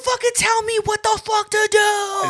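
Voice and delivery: very high, loud raging yell; imitating furious shout. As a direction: scream at the tow driver. Neighbor yelling at driver.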